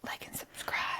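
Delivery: soft whispered sign-off; playful secretive whisper. Pretend. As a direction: whisper it very softly, all breath. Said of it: "we're whispering again".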